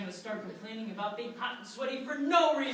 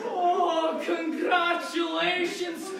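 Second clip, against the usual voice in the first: high, loud, drawn-out congratulations; jubilant announcement; 5 semitones higher, 5 dB louder, much slower than usual. Acted. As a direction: proclaim a prize winner with a shout. Agent announces the prize ticket.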